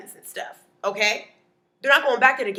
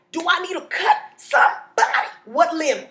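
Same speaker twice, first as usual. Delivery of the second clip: high and loud, itching for a fight; hammed-up neighbor offering to handle it; eager neighbor; 7 semitones higher, 4 dB louder than usual. Neighbor offering to cut somebody.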